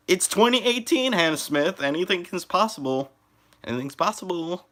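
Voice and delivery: mock announcer.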